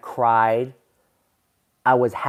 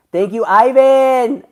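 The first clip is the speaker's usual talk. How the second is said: excited thanks; 10 semitones higher, 8 dB louder, slower than usual. Real, not acted. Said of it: "excited thanks to chat".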